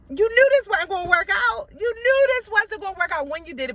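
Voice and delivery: high, loud, exasperated telling-off; mock scolding. Speaker tells herself off for having no patience, exasperated and high-pitched. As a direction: pitch it way up in disbelief, rushing the repeat.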